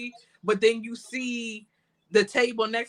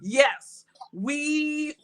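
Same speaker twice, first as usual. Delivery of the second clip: ordinary talk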